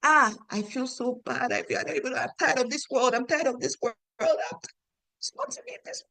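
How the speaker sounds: high, soft mock whimper; acted weary despair. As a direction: play a mother at the end of her tether, voice thinning away. Voicing a worn-out, despairing mother.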